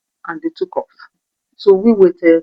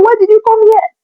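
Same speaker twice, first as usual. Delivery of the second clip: high, tearful, loud pleading; mother distressed at the kids, sobbing; crying mother; 9 dB louder than usual. Retold or imitated. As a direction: voice the mother near tears. Mother upset, crying voice heard.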